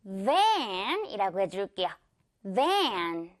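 "van" slow with a buzzing v; clear English V. Shown as a demonstration. Teacher modeling van for learners, slow clear V. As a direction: stretch "van" from a toothy v. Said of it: "이 V 발음 살려서".

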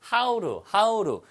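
"how to" said as how-ru; flapped t. Shown as a demonstration. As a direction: turn the t of "how to" into a Korean ㄹ. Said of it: "트 발음은 항상 이렇게 리을로 변합니다".

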